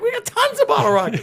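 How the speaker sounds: high, bright fake cheer; overeager salesman. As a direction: sell the lie with a grin. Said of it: "I'm like".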